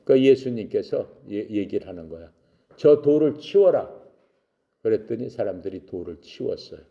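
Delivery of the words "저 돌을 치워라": raised, louder command; pitched-up acted command; commanding call. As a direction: order it out with authority. Jesus commanding at the tomb.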